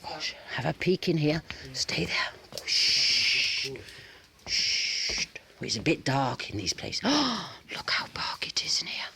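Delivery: hushed whispering; soft, sneaky whisper. Real, not acted. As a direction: whisper as you peek inside. Peeking into a house.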